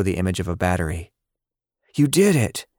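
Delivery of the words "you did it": excited, much higher pitch; congratulating. A narrator's reading. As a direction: cheer him on. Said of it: "William congratulated him".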